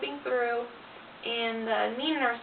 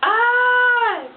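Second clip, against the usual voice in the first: long, loud, high groan; drawn-out high cry of dismay; exasperated groan; 12 semitones higher, 13 dB louder than usual. Real, not acted. Mother recalling being nine centimeters, loud groan of dismay.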